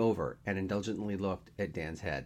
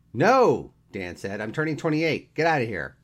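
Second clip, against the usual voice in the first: loud, high indignant denial; 6 semitones higher, 10 dB louder than usual. A narrator's reading. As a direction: shoo him out, loudly. Dan angrily denies his age.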